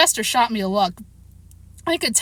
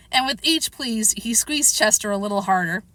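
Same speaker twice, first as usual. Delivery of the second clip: plain reading voice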